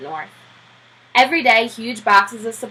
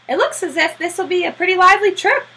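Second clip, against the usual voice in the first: sailors voiced; 10 semitones higher, 4 dB louder than usual. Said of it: "they would say".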